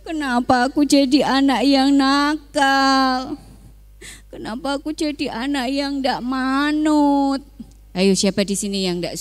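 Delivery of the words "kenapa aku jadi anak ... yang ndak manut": tearful prayer to God, higher and slow; a sorry son weeping to God; crying. A narrator's reading. The youngest son crying to God, pleading for forgiveness. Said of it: "menangis dan bilang kepada".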